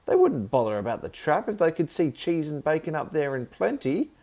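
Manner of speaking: high, loud lecturing voice; telling off her nephew; shrill loud telling-off; scolding aunt. Acted.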